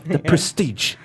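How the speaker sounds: hammed-up British delivery; put-on British accent. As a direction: announce it in amateur British. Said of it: "I can't do British very well".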